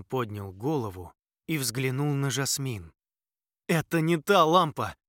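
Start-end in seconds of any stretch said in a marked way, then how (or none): 3.7-5.0 loud despairing cry; groaning. A narrator's reading. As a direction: groan out the bad news. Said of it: "простонал он".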